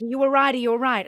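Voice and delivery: urgent worried call. Retold or imitated. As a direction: call down anxiously, voice lifted. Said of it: "she's like".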